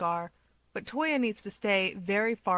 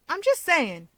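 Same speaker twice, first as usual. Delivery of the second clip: higher and louder, hands up, defensive; defensive Keigo; 9 semitones higher, 6 dB louder than usual. Acted. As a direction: back off while still insisting.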